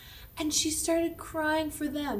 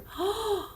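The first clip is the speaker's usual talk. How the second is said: shocked gasp; 8 semitones higher than usual. Acted. Queen sees herself in mirror.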